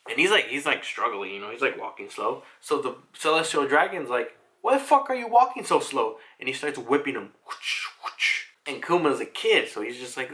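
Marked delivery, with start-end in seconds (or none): 4.6-6.2 high-pitched imitation of an angry master; hammed-up indignant demand; mock angry berating. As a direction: berate a slave, acted in retelling. Slaver berating a slave in retelling.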